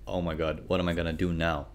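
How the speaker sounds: hushed, fast mock panic. Trader imitating panicked buyers, hushed and fast. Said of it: "They're like".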